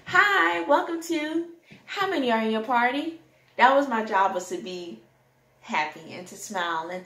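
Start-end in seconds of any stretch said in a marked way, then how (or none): 0.0-3.2 a hint of a chirpy hostess; hostess greeting. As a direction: greet diners at the door, all smiles. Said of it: "smiling and being welcoming".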